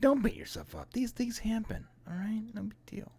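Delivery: high, soft put-on reassurance; mock consoling. Consoling someone over Duke's death, high soft mock comfort.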